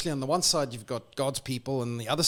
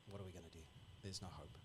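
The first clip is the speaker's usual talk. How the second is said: very soft, defeated sigh of words; a much quieter, giving-up mumble; hopeless murmur; 6 semitones lower, 25 dB quieter, much faster than usual. Retold or imitated. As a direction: let it sink away in despair. Imagined voice of despair.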